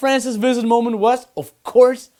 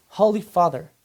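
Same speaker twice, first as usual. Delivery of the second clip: ordinary delivery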